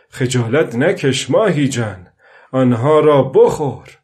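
put-on old fisherman calling loudly to the fish; calling aloud. Old man calling loudly to the marlin below. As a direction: call down into the sea, coaxing loudly. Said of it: "پیرمرد با صدای بلند گفت".

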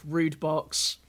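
normal talking voice

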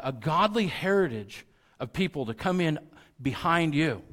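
high, loud sermon exhortation; impassioned preaching. Real, not acted. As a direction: drive each phrase home as an urgent charge. Preaches at full force, voicing Paul.

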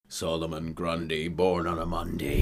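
Grundy voice. Retold or imitated. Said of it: "What's his name, Neil?".

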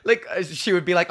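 ordinary talk, nothing marked

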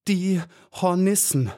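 high, halting, strained announcement; laboured, forced out. A narrator's reading. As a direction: force the words out with effort, pausing between. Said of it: "brachte sie mühsam nur die Worte hervor". (mühsam hervorgebracht, hoch und stockend)

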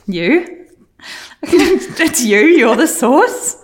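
high, slow, laughing you're the source; laughing accusation. Real, not acted. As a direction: accuse her through laughter.

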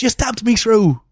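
high, loud mock Irish cry; bad Irish accent. Guest imitates stabbed film heroine, mock Irish accent. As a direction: exclaim it in hammed-up Irish. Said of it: "And she goes".

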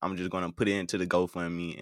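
ordinary talk

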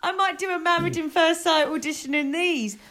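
talking through big laughter; laughing loudly. Real, not acted. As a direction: crack up mid-sentence. Laughing while joking.

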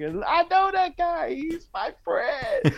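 a fan bragging, so thrilled it nearly breaks; excited fan. Retold or imitated. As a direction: fan in a club shouting that he knows the singer, high and overjoyed. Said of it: "people send you clips on WhatsApp".

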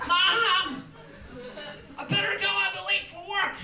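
high, loud, hammed-up family argument; American TV drama. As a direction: overact a frantic late-for-work scene. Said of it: "Everything on American T V is about work".

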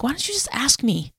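high, gently prodding voice; Holy Spirit. Retold or imitated. Imitated divine prompt to someone overthinking in prayer, gently prodding. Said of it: "the Holy Spirit's like".

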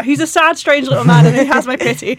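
mock lofty Toy Story pity; quoting Toy Story. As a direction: recite it like the movie line, a touch hammed. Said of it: "I'm quoting Toy Story now".

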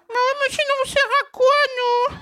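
high, loud squeaky ovary voice; ovaries voice. Acted. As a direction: squeal it like a tiny outraged organ. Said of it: "j'ai fait parler mes ovaires".

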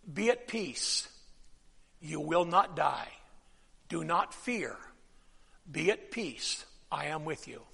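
soft, low reassurance spoken over a frightened man; hushed comfort. Acted. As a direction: hush the room and speak peace over them. Preacher softly voices God's comfort.

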